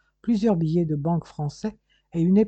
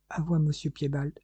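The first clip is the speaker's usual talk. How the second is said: ordinary delivery, nothing marked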